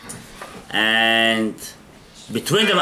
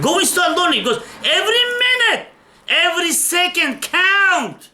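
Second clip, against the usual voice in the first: urgent preaching; 11 semitones higher than usual. Real, not acted. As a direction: drive the point home, each word weighted. Preacher's urgent closing exhortation.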